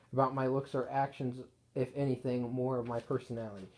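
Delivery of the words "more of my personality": ordinary delivery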